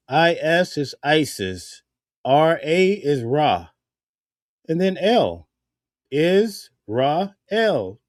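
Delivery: slow, syllable by syllable: "Is Ra El"; spelling out slowly. Shown as a demonstration. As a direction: break Israel into Isis, Ra, El slowly. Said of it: "Even when you look at the word Israel".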